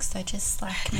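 breathy playful whisper. Pretend. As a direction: whisper it like a secret crush. Mock office crush whisper.

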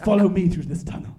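calling out. Acted. Mysterious guide calling out.

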